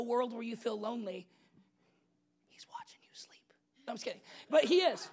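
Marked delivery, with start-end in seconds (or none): 2.5-3.4 breathy, hushed creepy whisper; spooky joke whisper. Pretend. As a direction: whisper it like a stalker.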